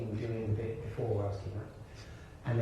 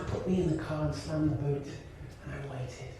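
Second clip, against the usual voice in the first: ordinary delivery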